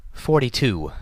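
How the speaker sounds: Rarity announcing. A narrator's reading. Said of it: "Rarity announced".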